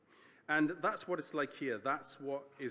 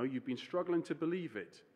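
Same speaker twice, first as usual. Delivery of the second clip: ordinary talk, nothing marked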